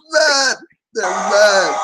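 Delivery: high, teasing call of mad; mocking high taunt. Real, not acted. Host taunting angry Warriors fans, mocking. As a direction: gloat at the losers.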